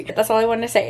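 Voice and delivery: normal talking voice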